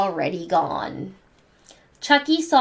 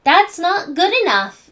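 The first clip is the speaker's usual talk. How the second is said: high, louder bratty growl; 9 semitones higher, 5 dB louder than usual. A narrator's reading. Angelica refusing the babies' candy, bratty growl. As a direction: snap it back like a spoiled bossy girl. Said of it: "growled Angelica".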